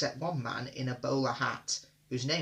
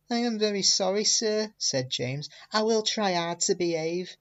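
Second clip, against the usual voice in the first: higher, humble engine voice; sorry engine James; 6 semitones higher than usual. A narrator's reading. James to the Fat Controller, higher and humble. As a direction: apologise like a chastened little engine.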